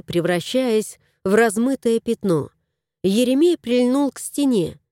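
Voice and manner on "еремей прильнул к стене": plain reading voice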